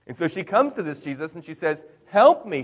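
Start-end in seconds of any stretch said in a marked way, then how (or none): 2.1-2.6 high, loud retold plea; begging. Canaanite woman begs Jesus for help, imitated begging. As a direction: beg urgently, voice leaping up. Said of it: "She's begging".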